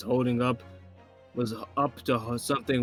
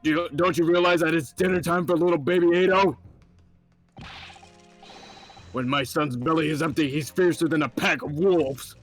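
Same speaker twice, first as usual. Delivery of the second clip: loud, higher gruff sergeant; 6 semitones higher, 7 dB louder than usual. Acted. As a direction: bark out like a soldier. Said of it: "what voice I gave him".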